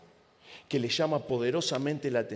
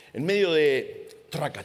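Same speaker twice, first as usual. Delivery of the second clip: usual speaking voice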